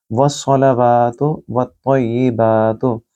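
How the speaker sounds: वस्सलावातु with a full, round स; slow recitation, heavy rounded s. Shown as a demonstration. Teacher demonstrating the phrase, round heavy s. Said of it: "सौद को आपने गोल पढ़ना है".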